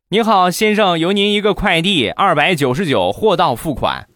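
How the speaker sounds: brisk service voice announcing cash on delivery; courier on phone. Acted.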